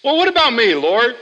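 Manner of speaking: high, loud mock whine; a touch of self-pity; whiny complaining prayer. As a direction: whine at God like a neglected sister. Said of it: "You ever prayed that prayer?".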